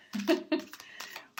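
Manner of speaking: high, loose chuckle; laugh. Real, not acted. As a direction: chuckle high after a warning. Laughs after giving a warning.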